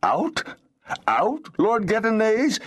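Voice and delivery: high-pitched, startled stammer. A narrator's reading. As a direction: stammer it as a shocked, high question. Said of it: "Felek stammered".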